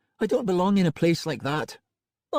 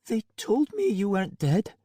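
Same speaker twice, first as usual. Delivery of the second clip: numb; 12 semitones higher than usual. A narrator's reading. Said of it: "she said numbly".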